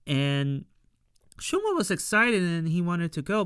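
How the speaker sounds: ordinary delivery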